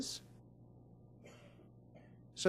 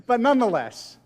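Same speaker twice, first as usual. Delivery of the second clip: laughing; 5 dB louder than usual. Real, not acted. Laughs at own joke.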